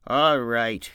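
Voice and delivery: loud, curt and gruff; brusque. Acted. George to Lenny, demanding the mouse, loud and brusque. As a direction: cut him off sharply. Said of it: "he said brusquely".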